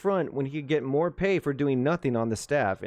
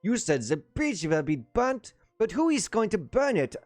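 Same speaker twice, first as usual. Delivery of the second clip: put-on thick German accent; 7 semitones higher than usual. A narrator's reading. Colonel protests to the staff officer in a thick German accent. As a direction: insist in broken German-accented English, aggrieved. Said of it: "his marked German accent worsening".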